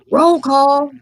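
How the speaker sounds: high, loud call across the woods; yelled out. Retold or imitated. Researcher calling the group back at night, yelling. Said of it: "he just yells out".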